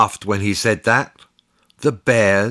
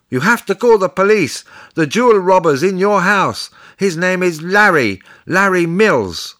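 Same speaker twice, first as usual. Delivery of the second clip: urgent report; 7 semitones higher than usual. A narrator's reading. Narrated child urgently reporting a robber.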